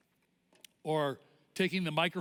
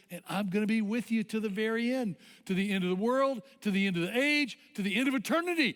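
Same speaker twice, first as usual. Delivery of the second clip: insistent promise climbing higher with each phrase; Jesus; 5 semitones higher than usual. Acted. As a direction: preach the promise, building to a high, quicker finish. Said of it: "he says".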